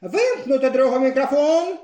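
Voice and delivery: голосно, вище, владно; обірвати промову криком; гучний наказ. A narrator's reading. Крижан кричить на мітинг. (Крижан голосно наказує вимкнути мікрофон)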